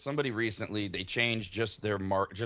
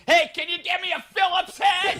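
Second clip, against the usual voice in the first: much higher, much louder hammed-up call; mock workman shout; 10 dB louder than usual. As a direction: bellow across the job site for a tool. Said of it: "You have to raise your voice".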